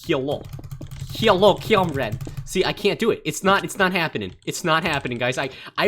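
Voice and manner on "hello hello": ordinary talk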